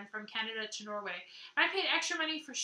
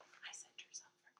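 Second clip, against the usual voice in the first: hushed confiding whisper; 13 dB quieter than usual. Real, not acted. The knitter whispers to viewers so Lena won't hear. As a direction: let the viewers in on a secret.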